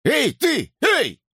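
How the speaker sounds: high, sharp calls; hailing. A narrator's reading. Policeman hailing a figure nearby, calling out. Searchers hailing someone in the dark.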